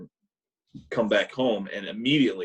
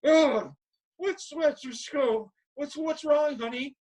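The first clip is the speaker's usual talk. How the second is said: groggy mom voice; slower than usual. Retold or imitated.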